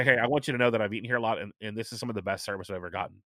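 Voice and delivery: quick, rushed praise to the manager; fast compliment. Retold or imitated. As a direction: rattle off the compliment, fading soft. Complimenting the server.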